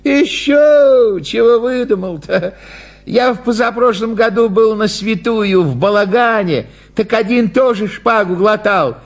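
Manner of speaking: high-pitched objecting storyteller; disapproving spectator. Acted. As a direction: dismiss the idea, then recount it high. (недовольный зритель, высоким голосом)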